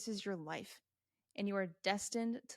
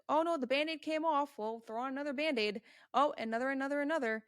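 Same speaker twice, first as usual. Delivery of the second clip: mock flustered self; 5 semitones higher, 5 dB louder than usual. Mock inner voice with Band-Aids.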